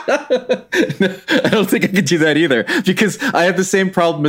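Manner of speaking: high, talking through laughter; laughing. Real, not acted. Declining to do Groundskeeper Willie, laughing. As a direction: laugh your way into the admission. Still laughing after the request.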